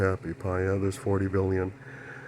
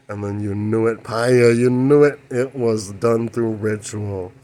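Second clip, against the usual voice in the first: emphatic; 2 semitones higher, 7 dB louder than usual. Real, not acted. Emphatic excited claim.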